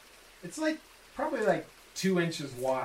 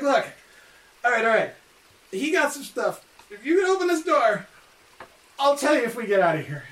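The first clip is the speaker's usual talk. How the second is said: excited NPC; 7 semitones higher, 5 dB louder than usual. Acted. GM voices laughing prisoner.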